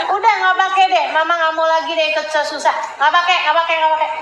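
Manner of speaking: exasperated mom shutting him down; nagging mother. Pretend. Mother refusing son's marriage.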